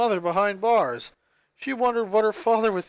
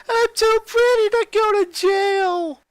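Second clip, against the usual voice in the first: put-on high, loud, slow wail of a little boy; Lenny crying out he's too pretty for jail; 14 semitones higher, 7 dB louder, slower than usual. A narrator's reading. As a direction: wail it out, much higher and louder. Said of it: "Lenny cried".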